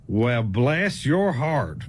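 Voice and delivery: drawn-out, low Southern put-down; condescending. Shown as a demonstration. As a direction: say it like they're an idiot. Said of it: "That means you a stupid ass".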